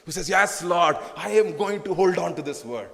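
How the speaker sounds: ordinary delivery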